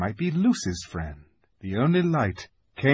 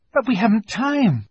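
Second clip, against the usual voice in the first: high, raised objection; urgent protest; 12 semitones higher, 6 dB louder than usual. A narrator's reading. Susan objects to Missus Beaver's packing, urgent. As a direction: protest the delay in a lifted voice.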